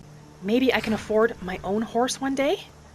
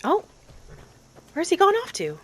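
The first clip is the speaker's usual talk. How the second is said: surprised question; 9 semitones higher, 4 dB louder than usual. Real, not acted. Horse wanders off.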